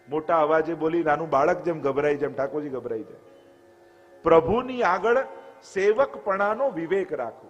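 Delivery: ordinary talk, nothing marked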